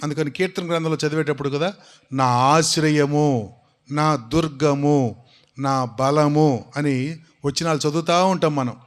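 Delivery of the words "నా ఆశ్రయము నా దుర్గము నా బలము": slow, deliberate psalm reading; slow recitation. Shown as a demonstration. Preacher recites the psalm slowly to the congregation. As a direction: weigh each phrase like scripture.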